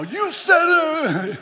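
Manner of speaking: high, loud, slow gloating; wife gloating, loud and high; triumphant mock shout. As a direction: throw his words back at him. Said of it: "you should have seen her coming back at me".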